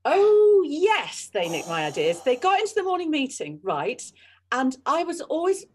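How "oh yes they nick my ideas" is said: mock sarcastic. Mocking the idea thieves.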